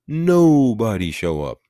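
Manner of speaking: rally the crowd to stay home; emphatic call. Real, not acted. Urging a voter boycott.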